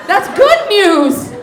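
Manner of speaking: high, loud comic celebration; jubilant shouting. Acted. Comedian telling saddened audience it's good news, exultant yell. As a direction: holler the good news at the crowd.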